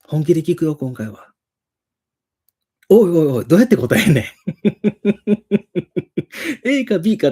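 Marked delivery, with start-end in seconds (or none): 2.9-4.2 loud, laughing "come on, how do I answer"; laughing exasperation. Real, not acted. Exasperated at absurd question. 4.3-6.6 high laugh. Real, not acted. Laughs at the question.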